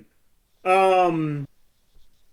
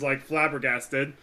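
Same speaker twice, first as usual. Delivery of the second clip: ordinary delivery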